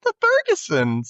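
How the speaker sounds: very high, drawn-out imitated girl; high girlish voice. Girl naming her relatives, in mock high voice. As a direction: stretch it out way up high. Retold phone call, girl answering.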